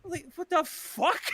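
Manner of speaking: high, slow, drawn-out disbelief; stunned, high-pitched reaction; bewildered. Retold or imitated. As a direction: stretch it out, dumbfounded. Stunned by the old man's rant.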